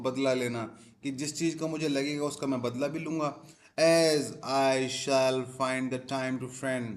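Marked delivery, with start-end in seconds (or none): 3.8-6.9 very slow reading. Shown as a demonstration. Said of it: "teacher reads text slowly".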